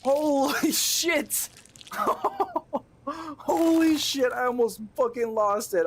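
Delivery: high, loud, drawn-out holy shit; relieved exclamation. Real, not acted. Streamer exclaiming in relief after almost losing. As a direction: let the tension burst out.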